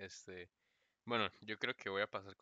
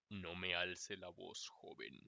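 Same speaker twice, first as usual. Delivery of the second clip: grave, lento y más suave, como profe severo; 4 semitones lower, 7 dB quieter, much slower than usual. Pretend. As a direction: corrige al alumno con autoridad seria. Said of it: "decirle como". (el futuro profe al alumno, grave y lento)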